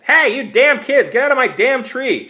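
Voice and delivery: put-on shouting kids off her property; yelled. A narrator's reading. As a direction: scream at the kids in her tree. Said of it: "she yelled".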